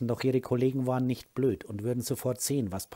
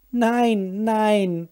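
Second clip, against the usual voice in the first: put-on crying child; 10 semitones higher, 7 dB louder than usual. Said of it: "Er fing an zu weinen".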